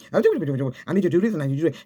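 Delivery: pushy demand. Retold or imitated. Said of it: "He didn't just go off and say".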